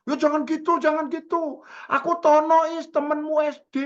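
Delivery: very high, loud, imitated pleading; eager, spirited caller. Forgotten schoolmate stops friend hanging up, high spirited protest. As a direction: protest excitedly to keep them on the line. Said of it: "Tadi semangat itu".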